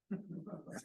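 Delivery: real laugh. Real, not acted. Laughs at the broken-rail cover-up.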